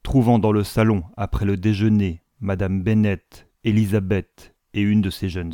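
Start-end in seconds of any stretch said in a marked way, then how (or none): none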